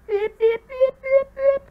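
high squeaky beep-beep noises; chirpy mock beeping, very high pitched; imitated beeping. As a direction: mimic an electronic alarm, squeaky and high. Mimicking a game alert sound.

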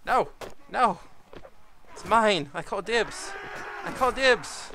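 loud, high cry to claim the kill; playful shouting. Real, not acted. Rushing for a kill.

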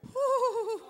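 high, drawn-out pious whoop; mock church mother's high "woo"; imitated church whoop. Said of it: "we had some church mothers".

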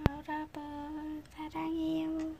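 high, slow and sweet; cutesy tender. Real, not acted. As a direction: coo it lovingly to viewers. Cute love message to viewers.

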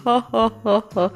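cheeky giggle at the plan; mischievous laugh. Pretend. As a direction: laugh like a kid plotting mischief. (ضحكة شقية)

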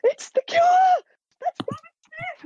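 very high, squeaky mock excitement; high-pitched imitation of wide-eyed kids; imitating amazed kids. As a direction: re-enact a child's thrilled recognition, way up high. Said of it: "their eyes just went super wide".

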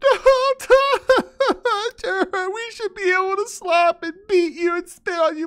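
very high, wailing mock cry; mocking falsetto. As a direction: whine like a spoiled princess. Mocking the woman's cries.

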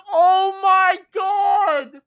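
very slow, loud, high shout; stunned shout. Real, not acted. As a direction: stretch it out in shock. Climactic reveal.

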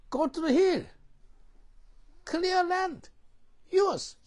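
high, loud preaching command; emphatic command. Retold or imitated. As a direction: thunder the order to get to work. Preacher repeats Joshua's command.

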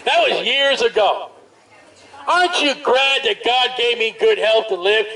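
usual speaking voice

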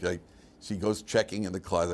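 ordinary delivery

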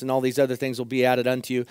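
ordinary delivery

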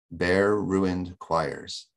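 "bare ruined choirs" drawn out very slowly; weight each word of "bare ruined choirs", taking a long time; three heavy stresses. Shown as a demonstration. Lecturer showing how the line halts us, slow heavy stresses. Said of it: "That takes a long time to say".

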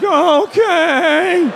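high, small, timid "okay"; meek okay. Retold or imitated. Teen signing a loan he doesn't understand, high and meek. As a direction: squeak out a helpless yes. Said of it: "I was like".

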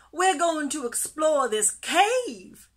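high, loud, bubbling announcement; bursting with excitement. A narrator's reading. Pufferfish telling his friend the plan, bursting with excitement. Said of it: "bursting with excitement".

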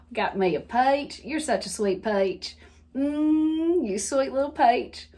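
relished Southern endearment, "peach" as "pie-tch"; syrupy Southern sweet-talk; Southern accent. Pretend. Calling someone a sweet little peach, Southern accent. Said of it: "heavy Southern accent".

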